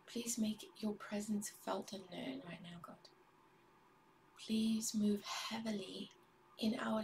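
soft, hushed pleading prayer to God; whispered prayer. Real, not acted. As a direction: pray under the breath, intimate and earnest.

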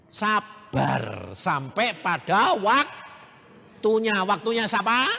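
loud, slow, drawn-out preaching; full-force preaching. Acted. Preacher exhorting the congregation to persevere, loud and slow. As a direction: thunder it from the pulpit.

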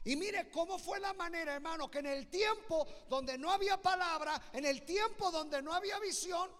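high, loud preacher's proclamation; full-force preaching. Real, not acted. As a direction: preach it out to the whole congregation at full voice.

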